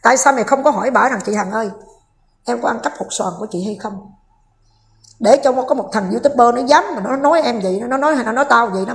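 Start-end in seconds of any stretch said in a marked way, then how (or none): 1.2-3.9 softer, lower, deferential quoted plea; polite imagined question. Retold or imitated. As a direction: ask it quietly and humbly, as a suggested line. Voices a polite question to Hằng. (giọng hỏi nhỏ nhẹ, lễ phép)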